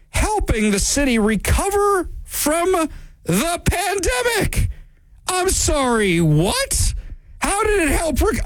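high-pitched, drawn-out disbelief; incredulous. Real, not acted. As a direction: stretch it out high as if you can't believe the claim. Reads mayor's claim, reacts in disbelief.

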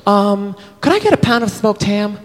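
put-on high, sweet girl's voice ordering at the deli; girly voice. Girl at the deli counter ordering ham, high and sweet. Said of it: "she goes".

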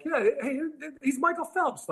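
very high mock-starstruck voice; excited onlookers. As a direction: imitate a fan announcing a celebrity.

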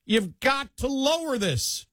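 high, slow, drawn-out mock demand; mock pleading demand. Voicing complaining Democrats.

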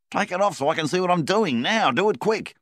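high, loud, pressing order; urgent command. A narrator's reading. Donnie hurrying Flynn to strip Milvan's shirt, high loud urgent order. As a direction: push him to hurry.

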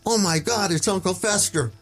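high, amazed outburst; mock shocked yell. Moviegoer shouting at the unmasked Vader, amazed. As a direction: gasp it out like a kid recognising a face. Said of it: "I yelled out".

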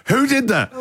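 high, sharp demanding question; mock a furious star demanding a culprit; angry demand. Dennis demanding who played his song, angry high voice. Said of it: "And then goes".

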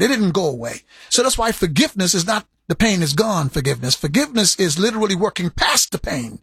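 ordinary delivery

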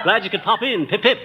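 laughing through a mock English accent; corrected English toff line. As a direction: fix the slip, still posh and giggling. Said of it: "I mean, uh".

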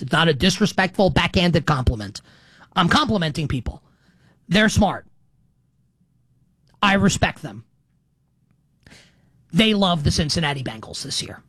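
ordinary talk

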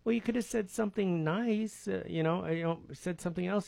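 lightly acted wounded kid brother; sensitive younger brother. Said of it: "kinda real sensitive".

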